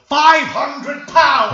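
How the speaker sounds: loud, high, slow yell. Retold or imitated. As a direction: bellow it out at a crawl. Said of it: "are you just gonna yell".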